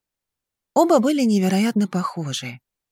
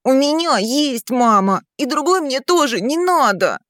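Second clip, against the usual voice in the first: put-on protesting child; 7 semitones higher than usual. A narrator's reading. Son refusing a new mother, high and insistent. Boy's protest to father continues.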